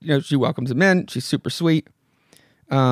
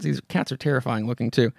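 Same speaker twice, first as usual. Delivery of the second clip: usual speaking voice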